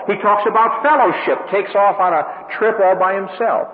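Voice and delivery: high, loud, rising preacher's cadence; full-force preaching. Acted. Preacher to congregation on Paul, preaching at full force. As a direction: drive each contrast home from the pulpit.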